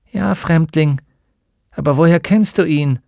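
a hint of a wary villager; lightly voiced curious old woman. A narrator's reading. Old woman answering the stranger.

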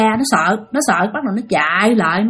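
ordinary delivery

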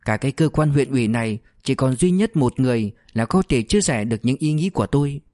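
usual speaking voice

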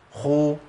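خو with breath running through the خ; خاء مضمومة مهموسة; kha with damma. Shown as a demonstration. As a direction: say خو with audible air on the خ. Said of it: "الخاء المتحركه بضم".

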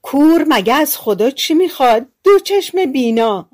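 wife exclaims at the cupboard of food; loud exclamation. Acted. Wife crows loudly over the feast. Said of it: "زن با صدای بلند گفت".

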